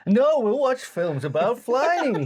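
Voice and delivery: a hammed-up cheerful reassurance; imitating Homer reassuring Marge. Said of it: "Margie's afraid of flying".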